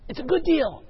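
whiny plea. Retold or imitated. Pleading with God over deal.

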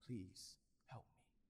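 near-whisper cry for help; hushed prayer. Retold or imitated. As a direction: say it faintly, as if afraid no one hears. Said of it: "I said".

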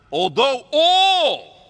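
very loud, high, drawn-out proclamation; booming, slow declaration; grand boast. Acted. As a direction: stretch it out like a sweeping boast. Peter begins his boast.

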